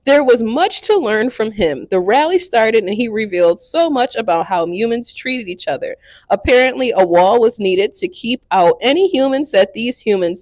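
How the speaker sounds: loud, lively read-aloud voice. Acted. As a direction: read the passage out with energy. Reads excerpt from the book.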